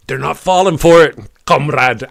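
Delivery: put-on loud, amateurish Russian accent; bad Russian accent. Streamer imagines Putin plotting in a little room, bad Russian accent. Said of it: "I wish I could do a better Russian accent".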